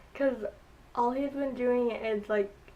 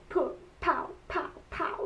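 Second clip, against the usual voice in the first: higher playful whispered pow sounds; explosion noises; 5 semitones higher than usual. Retold or imitated. Retelling Korra blasting the hatch, mock explosion sounds. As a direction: mimic blasts going off, lightly. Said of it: "she was like".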